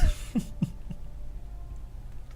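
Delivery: quiet, breathless giggle fit; wheezy real laughing; giddy laughing. Real, not acted. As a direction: collapse into near-silent laughter. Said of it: "I'm in a bit of a giddy mood".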